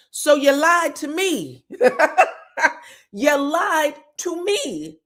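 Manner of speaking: high, slow, emphatic accusation broken by laughter; mock accusation. Recapper playing the doctor confronting Trisha, mock accusing. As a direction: draw out every word of the charge, amused.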